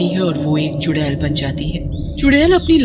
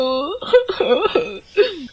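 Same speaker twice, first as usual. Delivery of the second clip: wicked laugh; 9 semitones higher than usual. Acted. Aunt's wicked laugh over fake grief.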